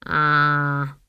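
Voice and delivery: drawn-out gloomy sigh of a word. A narrator's reading. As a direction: let it out glumly. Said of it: "said Granny gloomily".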